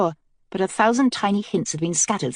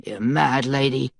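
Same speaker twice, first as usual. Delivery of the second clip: low mutter; 5 semitones lower than usual. A narrator's reading. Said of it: "Mace muttered".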